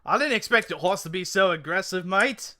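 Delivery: protesting mock-Cockney, drifting Aussie; muddled Cockney-Australian complaint; attempted Cockney. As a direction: protest in an amateur Cockney accent. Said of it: "I was trying to go Cockney".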